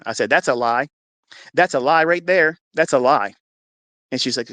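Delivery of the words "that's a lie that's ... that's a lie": loud, slow insistence; defiant denial. Retold or imitated. Husband rejecting the nurse's C-section warning. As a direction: refuse it flat out.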